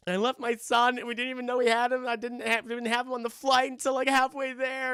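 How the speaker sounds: high, loud imitation of a crying mother; mock crying. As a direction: wail it out as the distraught mother. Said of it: "And she's crying".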